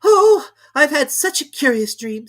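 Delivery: put-on high, loud eager girl; bursting to tell her dream; excited Alice. A narrator's reading. Alice wakes and tells her sister, high and eager. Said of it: "said Alice".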